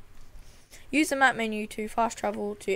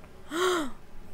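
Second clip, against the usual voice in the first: soft high intake of breath; gasp; 8 semitones higher, 4 dB quieter than usual. Real, not acted. Player spotting floating islands, soft high gasp. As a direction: gasp at a sudden sight.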